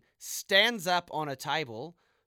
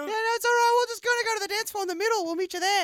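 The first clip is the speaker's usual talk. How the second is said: much higher put-on woman's voice; flirty woman Abatha; 6 dB louder, faster than usual.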